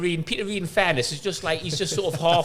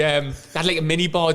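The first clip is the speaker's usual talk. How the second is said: ordinary talk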